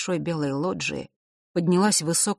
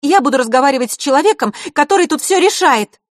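высокий громкий дерзкий голос девочки; требуй громко и дерзко; 10 semitones higher, 9 dB louder than usual. A narrator's reading. (громкое требование)

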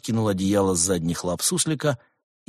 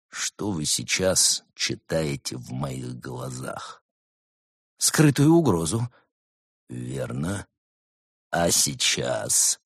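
lower, slow menacing questions; lightly voiced stern monk; 6 semitones lower, slower than usual. A narrator's reading. Monk staring into the gopher's eyes, low and slow. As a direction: stare him down with your voice.

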